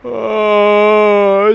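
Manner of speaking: high loud yawn-moan of pain. Real, not acted. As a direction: let out a loud pained yawn. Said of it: "a yawn and a moan of pain".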